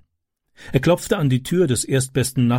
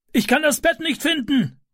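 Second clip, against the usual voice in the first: much higher, urgent call from above; neighbour calling down. A narrator's reading. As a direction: shout down from the window. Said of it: "rief er nach unten".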